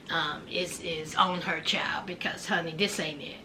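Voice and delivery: soft whispered aside. Real, not acted. Host sadly dismissing the mugshot, whispering. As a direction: drop to a whisper, shaking your head.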